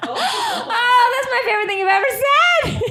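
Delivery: cracking up at a friend's crude joke; laughing shriek. Real, not acted. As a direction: squeal with delight through laughter. Delighted reaction to crude joke.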